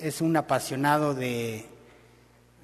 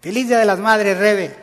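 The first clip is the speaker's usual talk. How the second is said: louder, brighter festive greeting; cheerful greeting; 5 semitones higher, 8 dB louder than usual. Retold or imitated. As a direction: greet her gladly with roses. Repite el saludo del esposo. (saludo alegre y fuerte)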